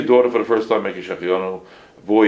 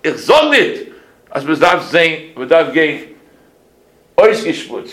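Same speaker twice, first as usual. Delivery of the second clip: put-on higher, louder old-world Yiddish lecture; imitating a Yiddish-speaking elder; Yiddish-speaking rosh yeshiva; 6 semitones higher, 5 dB louder, slower than usual. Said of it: "Then he says to me".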